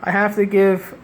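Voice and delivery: usual speaking voice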